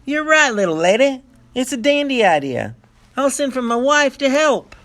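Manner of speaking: put-on pleased old man agreeing, grinning; smiling prospector. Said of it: "Then he said with a smile".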